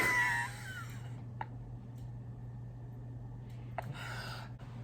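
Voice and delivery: high breathless wheeze of laughter; helpless laughter with no voice; silent laughing fit. Real, not acted. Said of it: "I am not laughing".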